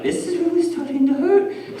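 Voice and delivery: high-pitched, worried complaint; mock pain. Acts out growing pain.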